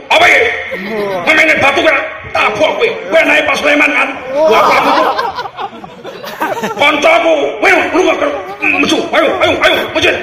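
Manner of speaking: loud, high street-tough bluster; rough fighter threat. Retold or imitated. Martial-arts fighter bullying gamblers to the mosque, loud rough. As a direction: scare them off like a thug. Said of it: "Kalau pendekar".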